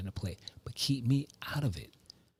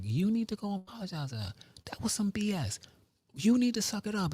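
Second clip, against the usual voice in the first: lightly acted, high-voiced reprimand; mock scolding; 10 semitones higher than usual. Imagined telling-off of the other person.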